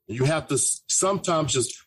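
ordinary delivery

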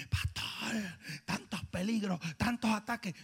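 a hint of a frightened whisper; timid whisper. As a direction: mimic a scared congregant. Mocking fearful church members.

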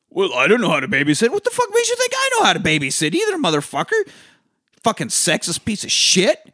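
quick, high-pitched mock outrage; acted indignant tirade; indignant retort. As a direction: rant back like an insulted partner. Acted quarrel over babysitting.

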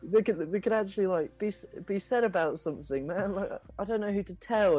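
high, hesitant storytelling voice; worried rat friend. Acted. Ratterson recalling the war to a friend, worried and high. Game master plays Ratterson.

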